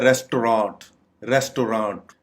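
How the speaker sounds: "रेस्टोरेंट" said RES-to-raat; correct pronunciation. Shown as a demonstration. Teacher modelling the correct word, "रेस्टोरेंट" as RES-to-raat. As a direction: end "रेस्टोरेंट" on a long open raat. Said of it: "इसकी correct pronunciation है".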